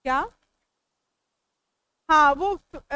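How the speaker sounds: ordinary talk, nothing marked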